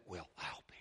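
softly stunned, pretending to be caught off guard; low, soft mock amazement; mock astonishment. Preacher imagining God hearing bad news, mock astonishment. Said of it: "you think God said".

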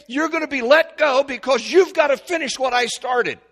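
high, loud pulpit preaching; fervent revival-style sermon voice; full-force preaching. Acted. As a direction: preach it out with rising fire to the congregation.

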